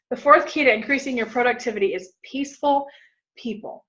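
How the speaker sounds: usual speaking voice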